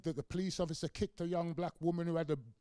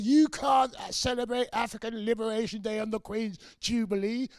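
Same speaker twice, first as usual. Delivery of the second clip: high, loud mock outrage from the conservatives; outraged official; 7 semitones higher, 9 dB louder than usual. Conservatives protest African Liberation Day, high loud outrage. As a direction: squawk it like scandalised Tories.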